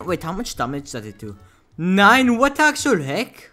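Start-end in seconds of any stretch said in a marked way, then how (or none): 1.8-3.5 loud, high outburst; pitched-up incredulous yell; shocked disbelief. Real, not acted. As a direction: shout it in astonishment. Shocked at damage number.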